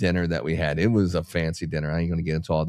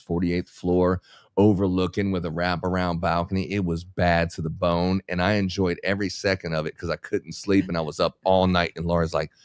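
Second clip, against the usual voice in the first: ordinary talk, nothing marked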